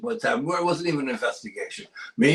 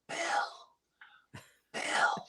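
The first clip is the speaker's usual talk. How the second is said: whispered name, twice, drawn out; ghostly whisper; 9 dB quieter than usual. Retold or imitated. Investigator imitating the voice on the tape, whispering. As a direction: breathe the name like a voice in the woods. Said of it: "just like that".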